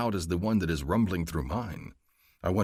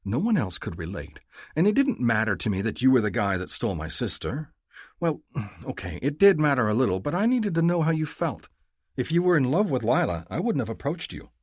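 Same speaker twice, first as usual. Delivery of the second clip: higher, louder female voice, earnest; Mahdi confiding; 6 semitones higher, 4 dB louder than usual. A narrator's reading. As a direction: confess it as the heroine. Mahdi's voice continues.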